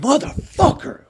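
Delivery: a sudden high yell of frustration; screamed curse. Retold or imitated. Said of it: "I just fucking screamed".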